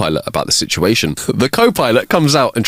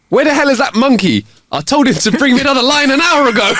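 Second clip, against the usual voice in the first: high-pitched mock-angry yell; 3 dB louder than usual. Panicked dog bluffing the lion, high hammed-up yell. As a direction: call out in put-on outrage, pitched way up. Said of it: "then yells".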